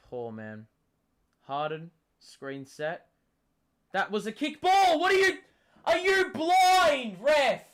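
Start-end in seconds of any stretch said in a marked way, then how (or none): 3.9-7.6 furious high yell at the ref; sideline yelling. Real, not acted. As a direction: scream it, outraged and loud. Yelling at the referee's call.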